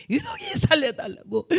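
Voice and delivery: imitated anguished cry, very high; retold screechy Jeolla prayer wail. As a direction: wail a desperate plea in Jeolla dialect. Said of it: "그 기가 막힌 절규의 기도".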